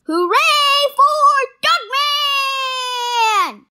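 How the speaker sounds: slow, high, stretched-out victory shout; drawn-out cheer. Acted. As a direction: cheer it like a crowd celebrating, slowly. Triumphant cheer after surgery.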